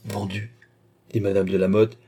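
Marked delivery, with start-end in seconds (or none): 0.1-0.5 soft, understated repeat of "Vendu"; uneasy echo. A narrator's reading. Lady companion echoing the queen's alarm, uneasy echo. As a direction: echo it softly, a touch of worry. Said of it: "inspira de l'inquiétude".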